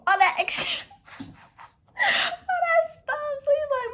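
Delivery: laughing through the words. Real, not acted. Mocking his sweet talk while laughing.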